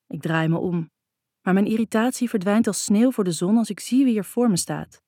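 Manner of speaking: usual speaking voice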